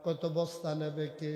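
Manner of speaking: ordinary talk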